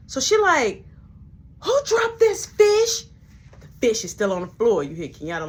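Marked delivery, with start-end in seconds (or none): 1.6-3.0 much higher, loud and slow demand; furious boss. Retold or imitated. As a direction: drag out the angry question.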